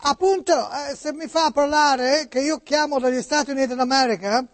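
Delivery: put-on Italian spoken with an American accent; high-pitched American notary on the phone. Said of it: "come parla Niculo? ... oggi americani".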